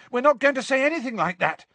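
fast, high, urgent protest; Harry shouting. Acted. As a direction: call out a hurried protest. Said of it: "Harry shouted".

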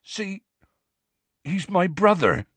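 high, halting confession; tearful. A narrator's reading. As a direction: confess it tearfully, pausing before the truth. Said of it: "tears now trickling down his bruised face".

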